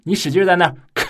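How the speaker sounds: ordinary talk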